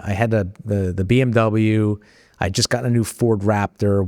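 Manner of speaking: ordinary talk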